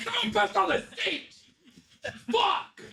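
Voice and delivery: very high, strained mock holler; hammed-up high-pitched outburst; imitated yelling. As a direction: imitate a man yelling across the shop, laughing through it. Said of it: "he'd be yelling that around the shop".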